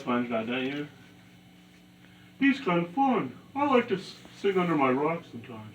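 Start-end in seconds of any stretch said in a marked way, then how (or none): 2.4-5.8 Patrick voice. Retold or imitated. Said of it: "learn how to do those voices".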